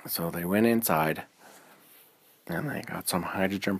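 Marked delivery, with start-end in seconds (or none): none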